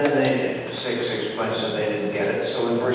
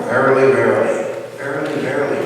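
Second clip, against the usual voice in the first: emphatic verily; 2 semitones higher, 7 dB louder, faster than usual. Acted. Quoting Jesus emphatically.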